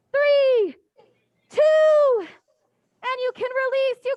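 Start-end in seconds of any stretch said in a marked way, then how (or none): 0.1-2.2 shouted count, much higher and louder; loud countdown. Real, not acted. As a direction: belt out the final count. Counting down the workout.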